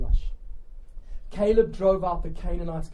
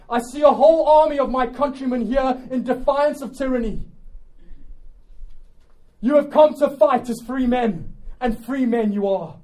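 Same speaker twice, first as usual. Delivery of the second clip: loud, raised-pitch warrior's speech; rally the troops as a commander; Braveheart-style battle oratory; rallying battle shout; 6 semitones higher, 6 dB louder than usual. Acted. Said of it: "shouting these words".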